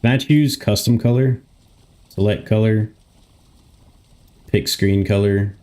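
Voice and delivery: ordinary talk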